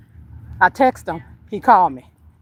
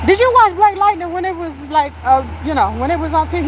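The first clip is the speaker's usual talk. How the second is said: pitched way up, quick at the end; excited high question; 9 semitones higher than usual. Retold or imitated. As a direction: build up to the question eagerly.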